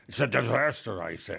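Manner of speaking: put-on booming old-time radio host; radio announcer voice. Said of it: "Let me say like a radio person".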